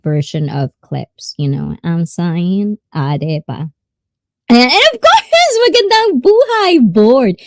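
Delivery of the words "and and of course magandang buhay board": high, loud, squealing greeting; gushing greeting. Pretend. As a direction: sing it out high like a big show greeting. Mock-grand greeting for fellow vlogger.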